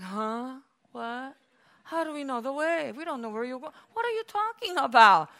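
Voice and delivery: hushed, baffled questioning that rises at the end; Thomas. Acted. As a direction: play a confused disciple, softly at first. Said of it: "Thomas, one of the disciples, is like".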